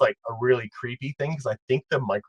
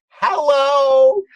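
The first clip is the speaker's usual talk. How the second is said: high, loud mechanical-voice hello; mimicked high-pitched truck holler; imitated truck yell; 13 semitones higher, 8 dB louder than usual. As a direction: yell it out like an ice cream truck. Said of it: "and it would yell".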